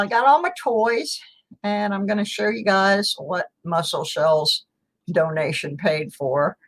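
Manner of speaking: ordinary talk, nothing marked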